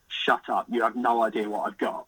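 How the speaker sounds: normal talking voice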